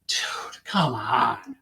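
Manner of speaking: hushed, whiny plea to his partner; pleading whisper. Retold or imitated. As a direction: beg him under your breath to go easy. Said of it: "I'm looking at him like".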